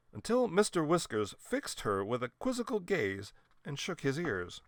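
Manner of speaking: ordinary delivery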